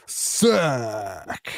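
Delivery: long, falling stadium yell; drawn-out yell. Shown as a demonstration. Co-host showing what fans yell for a sack, yelling. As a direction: stretch the one word like a crowd. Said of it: "you gotta yell".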